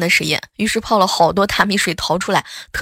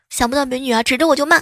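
higher, quicker, indignant telling-off; mock scolding; 6 semitones higher than usual. Woman on the phone scolding a stranger, higher and quick. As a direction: snap at the supposed creep.

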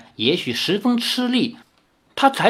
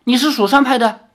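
startled; 9 semitones higher, 5 dB louder than usual. Acted. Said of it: "暗自心惊".

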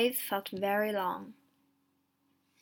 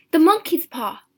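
high, loud excited answer; agitated, raised voice; urgent insistence; 7 semitones higher, 13 dB louder than usual. A narrator's reading. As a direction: blurt it out impatiently. Wife demands the paw.

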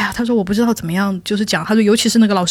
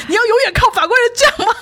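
high, loud scolding through laughter; laughing mock scolding of friend; laughing rebuke; 14 semitones higher, 3 dB louder than usual. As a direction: scold while cracking up. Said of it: "我就说".

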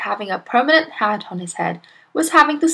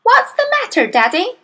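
put-on high, childlike questioning; little girl voice; 14 semitones higher, 5 dB louder than usual. A narrator's reading. Daughter to her father with stuck hat, little girl voice. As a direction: pipe up high like a young daughter.

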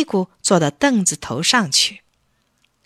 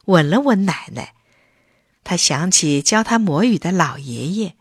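usual speaking voice

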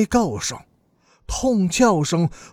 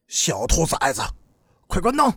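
contained growling order, pitched up at the end; fierce roar. A narrator's reading. Older brother ordering the boy to kill the light, fiercely. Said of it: "贺老大狠狠地吼着".

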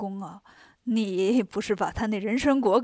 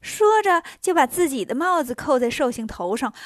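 usual speaking voice

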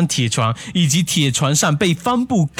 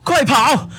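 higher-pitched cry of alarm; urgent yell; 10 semitones higher than usual. A narrator's reading. Narrator warns his friend to flee the ghostly woman, yelling. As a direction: call out a panicked warning, pitched high. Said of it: "我大叫一声".

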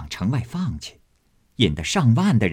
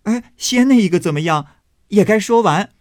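higher, louder, eager pressing; eager demand; 9 semitones higher, 5 dB louder than usual. Acted. Guests press Xifeng to finish the first story, higher and louder. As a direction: push her to finish the story. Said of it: "问他".

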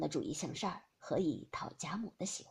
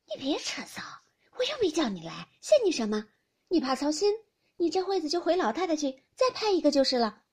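sharp, higher and louder lady's retort; Fengjie retorting; 6 semitones higher, 6 dB louder than usual. Acted. Fengjie brushing off Youshi's complaint, higher and louder. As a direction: toss the teasing back at her.

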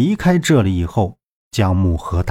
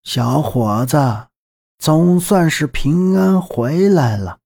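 put-on old woman voice; 4 semitones higher, slower than usual. Old grandmother character speaks.

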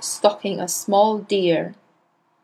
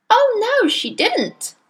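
put-on much higher piping child voice; piped; slower than usual. A narrator's reading. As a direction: pipe up eagerly in defence. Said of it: "piped Nigel".

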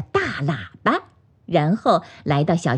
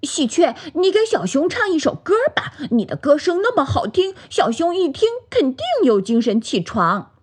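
high, quick, excited little pig; suddenly happy; 8 semitones higher than usual. A narrator's reading. Pig urges magpie to sing, high and happy. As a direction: bubble over with a new idea. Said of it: "小猪忽然高兴地说".

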